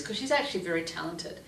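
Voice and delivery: ordinary talk, nothing marked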